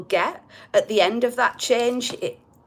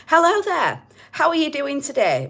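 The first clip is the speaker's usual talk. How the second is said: higher, prim telephone-voice greeting; posh telephone voice; 6 semitones higher than usual. Retold or imitated. Doing his ego as a prim telephone caller. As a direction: put on your best polite phone voice. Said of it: "My ego's my telephone voice".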